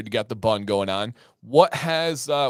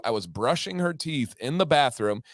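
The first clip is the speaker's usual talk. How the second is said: ordinary talk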